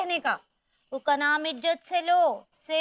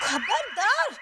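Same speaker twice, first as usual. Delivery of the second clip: angry warning; 4 semitones higher, much slower than usual. Acted.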